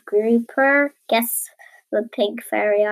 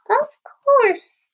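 very high whispered reply; secretary agreeing to the wasp; 12 semitones higher, 6 dB louder than usual. A narrator's reading. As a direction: breathe it up high and polite. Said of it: "said the secretary".

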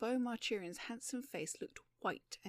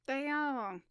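higher, louder captor's harsh voice; 5 semitones higher, 5 dB louder than usual. A narrator's reading. Captor behind the two gentlemen, a mildly harsh voice. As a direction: put some harshness into the announcement. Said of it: "said a harsh voice behind them".

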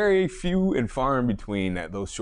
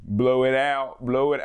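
badly imitated Delco catchphrase, higher; attempted Delco accent; 4 semitones higher, 4 dB louder, slower than usual. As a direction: try the trainer's Delco accent, half-succeeding. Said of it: "I can't do a Delco accent".

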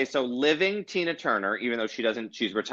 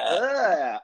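annoyed ugh; 7 semitones higher than usual. Retold or imitated. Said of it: "I was like".

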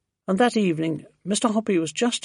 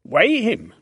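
louder, higher command; firm order; 6 semitones higher, 6 dB louder than usual. A narrator's reading. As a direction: order it done at once. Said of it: "Mister Hoppy ordered".

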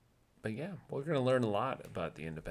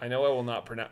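ordinary talk, nothing marked

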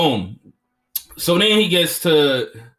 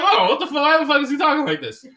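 high, fast "what the fuck?" of disbelief; be a baffled viewer, pitched way up; mock disbelief; 12 semitones higher, 3 dB louder, faster than usual. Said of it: "You'd be like".

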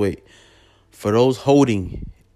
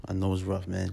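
dropping to near a murmur; quiet; 3 semitones lower, 10 dB quieter than usual. Real, not acted. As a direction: let it fall away softly. Repeats the line, then fades it.

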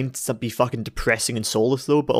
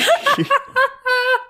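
a very high burst of laughter; shrieking laugh; 4 dB louder than usual. Real, not acted. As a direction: lose it completely, shrieking. Loses it laughing.

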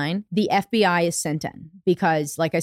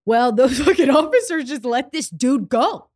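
louder, laughing through the outrage; can't believe it, laughing as you say it; real disbelieving laugh; laughing in disbelief; 6 semitones higher, 4 dB louder than usual. Real, not acted. Outraged laughing about officers' mistake.

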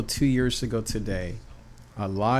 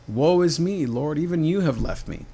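higher and louder, wailing woe; lamenting Elijah; 6 semitones higher, 5 dB louder than usual. Acted. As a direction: cry out as if abandoned. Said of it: "Elijah might as well have said that".

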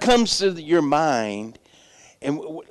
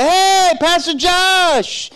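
high, loud, drawn-out greeting; big friendly holler, imitated; hearty hail; 12 semitones higher, 5 dB louder, much slower than usual. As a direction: call out to a friend across the store. Stranger hails pastor across store.

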